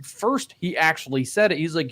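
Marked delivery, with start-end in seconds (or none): none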